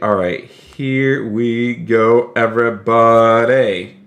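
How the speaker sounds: ordinary talk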